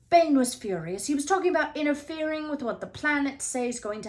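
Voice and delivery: agitated. A narrator's reading. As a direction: pour it out in a rush of nerves. Harry agitated, feverish.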